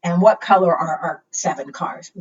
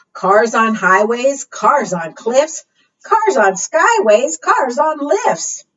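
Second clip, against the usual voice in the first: rhyming read-aloud; 5 semitones higher, 6 dB louder, slower than usual. Acted. Reading rhyming car book aloud.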